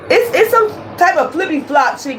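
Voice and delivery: talking through laughter; giggling as you say it; laughing. Real, not acted.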